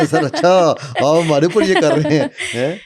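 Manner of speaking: a light mock complaint from an audience; mock accusing audience. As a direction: tease as if caught out by students. Mock audience catching him out.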